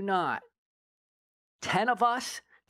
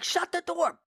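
high-pitched retold command; mock urgent order; 11 semitones higher than usual. Urgent order while forcing door shut.

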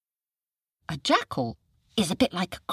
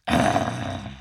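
tiger growl; 3 dB louder than usual. Acted. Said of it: "and growled".

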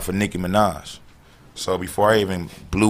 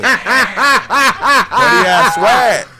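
slow laughing words; 13 semitones higher, 8 dB louder, much slower than usual. Real, not acted. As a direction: stretch it out while laughing. Laughing, drawn out.

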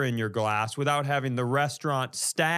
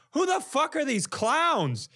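much higher, slow disbelief; retold shocked outburst, pitched way up; louder incredulity; 12 semitones higher than usual. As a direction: really let the disbelief rise. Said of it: "I just went".

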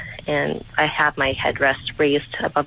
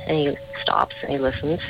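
normal talking voice

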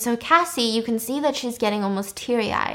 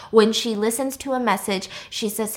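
normal talking voice

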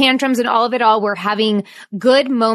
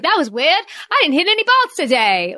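a hint of a Boston kid's accent, pitched higher; 6 semitones higher than usual. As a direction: lightly mimic a five-year-old with a Boston accent. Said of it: "weird, like, Boston accent".

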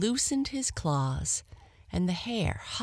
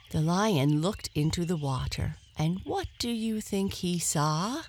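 usual speaking voice

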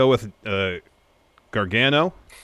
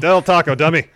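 loud, high-pitched cocky wrestler voice; loud wrestler impression, raised pitch; L A Knight; 7 semitones higher, 9 dB louder than usual. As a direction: boom it out like a wrestler cutting a promo. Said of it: "conversation between L A Knight".

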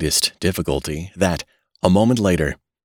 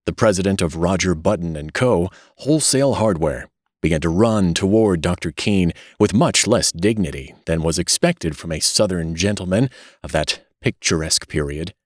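ordinary delivery

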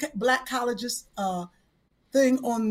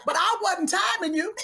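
normal talking voice

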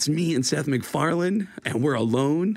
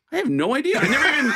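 high, laughing blurt; giddy admission; 12 semitones higher, 3 dB louder, much faster than usual. Retold or imitated. Comedian admitting to friends he never asked, giddy. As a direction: laugh it out, pitch way up. Retelling his excited reply to friends.

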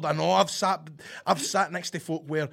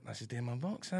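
soft, low cinema mutter; muted grumble; 5 semitones lower, 12 dB quieter than usual. Retold or imitated. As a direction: complain under your breath so the film carries on. Retold muttered complaint during film.

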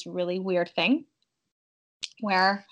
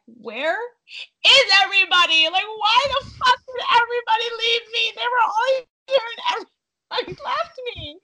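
high, loud, frantic wail; panicked cry; 11 semitones higher, 5 dB louder than usual. Retold or imitated. Alone at night in labor, panicked high shout. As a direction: shriek it out like you've been abandoned.

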